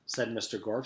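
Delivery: ordinary delivery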